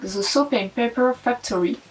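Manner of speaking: brand name in a hammed-up English accent; playful put-on English accent. Shown as a demonstration. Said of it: "avec mon super accent anglais".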